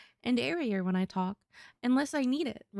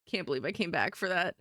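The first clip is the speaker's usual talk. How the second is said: ordinary talk, nothing marked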